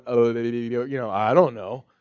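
low, soft, slow mumble; imitated Job at a loss; sheepish Job. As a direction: mumble it quietly, as if stumped for an answer. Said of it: "And Job's going".

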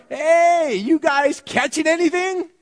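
high, loud call across the water; hollering. Retold or imitated. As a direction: shout it from the shore. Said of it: "Jesus hollers to them from the shore".

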